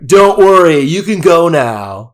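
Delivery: put-on high, loud, slow Pennsylvania accent; drawn-out Pennsylvania accent, hammed up. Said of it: "they're from Pennsylvania".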